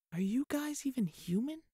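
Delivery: put-on girl marvelling at the giant knights; awed wonder. A narrator's reading. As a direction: breathe it in disbelief to yourself. Fee's awed private thought.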